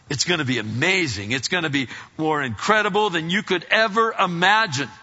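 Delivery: rising to a loud, high climax; building excitement. Real, not acted. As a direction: build up to an amazed proclamation. Preacher builds up heaven's wonders.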